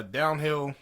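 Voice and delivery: ordinary talk, nothing marked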